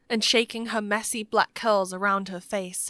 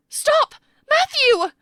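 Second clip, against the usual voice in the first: very high, loud, frantic cry; screamed command; 8 dB louder, slower than usual. A narrator's reading. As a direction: scream to halt an attacker. Calls out as he chases child.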